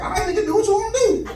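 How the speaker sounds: high, fast dismissal; dismissive dad. Retold or imitated. Father on the phone brushing off his son's plan, high and fast. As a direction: brush him off quickly. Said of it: "type of dude my dad is".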